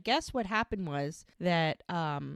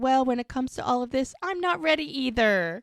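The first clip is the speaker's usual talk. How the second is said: high, louder mock confession to a baby; confiding baby talk; 8 semitones higher, 6 dB louder than usual. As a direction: playfully admit it to the baby, imitating him.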